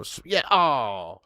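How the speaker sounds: normal talking voice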